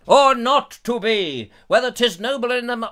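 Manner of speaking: loud, high hammed-up soliloquy; Shakespeare stuff. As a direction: declaim it grandly, stretching the opening. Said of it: "I could do all the Shakespeare stuff".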